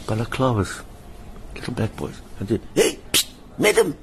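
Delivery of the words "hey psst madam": whispered psst then higher call of madam; hissed call. Retold or imitated. Little boys sneaking a call to the madam, hissed. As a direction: get her attention on the sly. Kids calling to him secretly.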